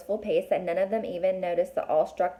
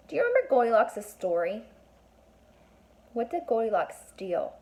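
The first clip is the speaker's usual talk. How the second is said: ordinary delivery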